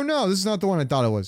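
normal talking voice

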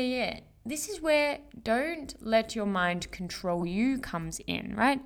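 usual speaking voice

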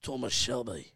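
low, soft Birmingham gangster voice; Thomas Shelby. Acted. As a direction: speak low and quiet in mock Brummie. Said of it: "I'm trying to channel".

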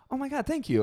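very high mock gushing; gushing thanks. Woman thanking seatmate for opening her bottle, mock gushing. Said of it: "then she's like".

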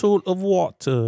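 high, put-on English accent. Shown as a demonstration. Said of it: "in an English accent".

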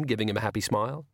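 ordinary delivery, nothing marked

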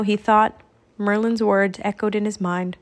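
ordinary delivery

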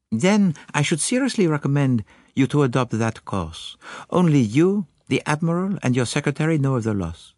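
put-on high, earnest accented detective; Poirot. Detective reassuring the lord, high earnest voice. Poirot's dialogue in narrated story.

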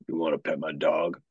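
low, oily offer to pet the dog; low creepy pickup line. Retold or imitated. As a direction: sell it like a sleazy pickup line. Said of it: "You have to say it like that".

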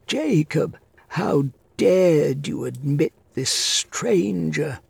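high, slow, stern rebuke; scolding old man. A narrator's reading. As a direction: scold the servant sharply. Master scolds his servant.

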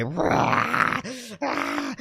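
put-on raspy roar of a raging kid; mock furious roar. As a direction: act out a child's tantrum roar. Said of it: "fucking furious".

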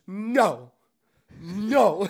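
high, loud, panicked cry of no. Retold or imitated. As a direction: recoil with a loud frightened no. Roach flew and hit him.

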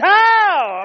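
a loud high burst of amazement; excited shout. Real, not acted. Preacher marvelling at the aunt's shout, loud and high. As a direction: shout it out in wonder.

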